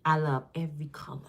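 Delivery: whispered, gentle; soft whisper. Real, not acted. Easing the hate with a soft whisper. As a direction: hush it tenderly. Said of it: "I gotta ease this up".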